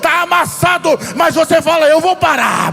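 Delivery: preaching at full force. Acted. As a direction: preach it with fire to the congregation. Preaching builds to shouting.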